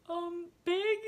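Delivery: confused reply. Retold or imitated. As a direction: imitate a baffled, high-pitched answer. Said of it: "being so confused".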